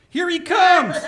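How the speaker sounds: high, loud warning shout; alarmed shout. Acted. Townsman warns the giant baby approaches, alarmed shout. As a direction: call out the danger.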